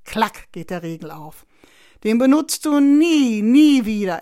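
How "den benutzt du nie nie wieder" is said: loud, emphatic scolding; forceful warning, leaning hard on "nie"; stern admonishing. A narrator's reading. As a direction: lay down the law to a little brother. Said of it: "ermahnt Sebastian seinen kleinen Bruder".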